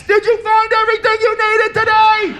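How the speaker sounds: high shouted cashier question; muffled yell through glass. Retold or imitated. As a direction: call it out through plexiglass. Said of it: "yelling through the glass".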